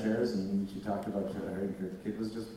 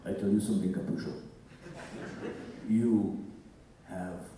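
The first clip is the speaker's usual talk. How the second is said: put-on Russian accent. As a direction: speak English with a thick Russian accent. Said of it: "could you do the Russian accent".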